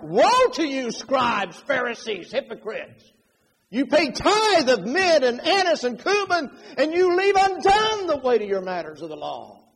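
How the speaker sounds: high, loud, thundering denunciation; angry rebuke. Shown as a demonstration. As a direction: rebuke them at full force, hammering each charge. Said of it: "I think Jesus was more like".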